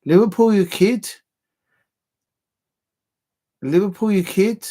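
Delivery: loud, high, lilting Scouse; attempted Scouse accent. Shown as a demonstration. Fan practising a local catchphrase, exaggerated Scouse accent. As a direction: belt out a hammed-up Scouse accent. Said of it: "a Scouser's accent".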